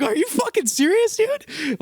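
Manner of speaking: high, incredulous "are you serious"; mock exasperated self. Salesman mocking his own reaction, high incredulous disbelief. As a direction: squeak it out in disbelief.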